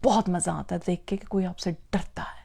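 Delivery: whisper. Shown as a demonstration. Confiding the cruel pleasure.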